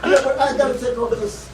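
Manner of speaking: usual speaking voice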